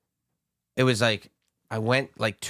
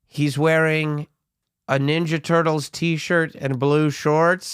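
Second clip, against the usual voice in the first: very slow, drawn-out, louder and higher loudspeaker call; store PA announcement; 4 semitones higher, 4 dB louder, much slower than usual. Retold or imitated. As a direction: stretch it out like a store intercom page. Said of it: "And they go".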